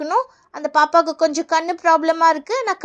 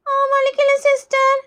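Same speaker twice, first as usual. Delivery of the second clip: high, slow schoolkid voice; drawn-out little-kid reply; child pupil; 5 semitones higher, slower than usual. Acted. Pupil telling nurse it doesn't hurt, slow child voice.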